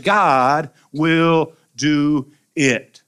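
"will do it" drawn out slow; very slow emphasis. Shown as a demonstration. Preacher assuring the congregation God answers, slowly. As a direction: lean on every word.

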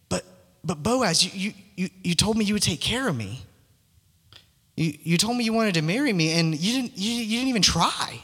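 a wounded bride stumbling over her words; Ruth pleading. Retold or imitated. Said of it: "you imagine Ruth saying".

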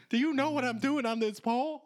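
hammed-up stripper defending her art; mock indignant stripper. Stripper quitting on stage tells the crowd off, high mock indignation. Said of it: "Take your sickles back".

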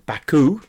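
"Baku" ending in "oo", rising in question; "Baku" said bah-koo with a questioning lift; questioned pronunciation. Shown as a demonstration. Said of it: "complaining about my pronunciation".

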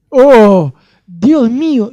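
shocked exclamation. Real, not acted. Shocked by seductive selfies.

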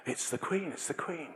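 soft whisper, lifting on Queen; quiet thrilled there she is; excited whisper. Retold or imitated. Retelling peeking at Queen.

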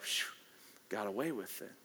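relieved sigh. Retold or imitated. Congregation relief, acted.

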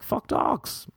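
high-pitched indignant outburst; mock outburst. Bitten man cursing all dogs, mock indignant. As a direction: pitch leaps up in mock protest.

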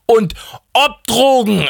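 high, drawn-out outburst; emphatic exclamation. Acted. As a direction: stretch it out in worked-up confirmation. God exclaims, confirming drug use. (hoch, gedehnt und aufgebracht bekräftigt)

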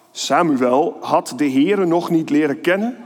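ordinary delivery

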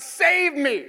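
high desperate shout for rescue. A narrator's reading. Peter shouts to Jesus from the waves, high pleading shout. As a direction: cry out as the water closes in. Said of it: "He shouted".

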